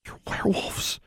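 whispered in shock; stunned whisper. A narrator's reading. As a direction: breathe it out in disbelief. Stunned realization.

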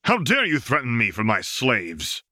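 bristling at being threatened; indignant outrage. A narrator's reading. Slave master confronting the Wraith, outraged and indignant. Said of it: "the master yelled".

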